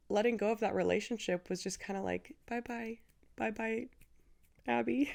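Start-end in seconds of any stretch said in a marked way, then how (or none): 2.5-5.1 sing-song goodbye. Retold or imitated. Mock farewell to her old self.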